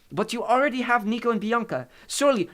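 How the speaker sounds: put-on high, loud Italian-accented protest. A narrator's reading. Maria protests to Hades, in an Italian accent. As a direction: object urgently in an Italian accent.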